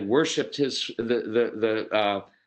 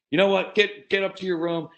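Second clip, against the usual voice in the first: angry order; 7 semitones higher than usual. Retold or imitated. Dad snapping at his shouting son, sharply angry. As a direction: snap it out like a fed-up dad.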